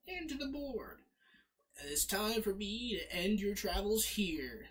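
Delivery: high, hammed-up Southern boss voice; horrible Southern accent. Old man challenges player to boss battle, exaggerated Southern accent. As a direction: push a bad Southern accent up high and theatrical. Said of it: "That's a horrible southern accent".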